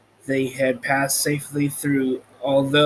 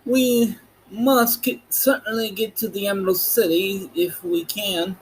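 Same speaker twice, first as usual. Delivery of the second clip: higher, lightly voiced Scarecrow; 6 semitones higher than usual. Scarecrow urging the others on, lightly pitched up. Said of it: "the Scarecrow continued".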